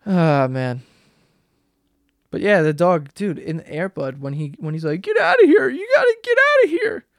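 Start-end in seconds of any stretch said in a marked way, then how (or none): 5.0-7.0 high-pitched yell, sending the dog away; acted yelling. Kid ordering his dog to leave, high yelling. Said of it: "Why are you yelling at me".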